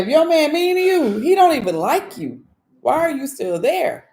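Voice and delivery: high, loud, preachy insistence; exclaimed mock lecture, slowing at the end; emphatic retelling. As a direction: drive the advice home up high. Hammers home the man's advice.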